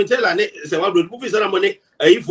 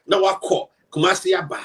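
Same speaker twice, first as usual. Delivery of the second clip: usual speaking voice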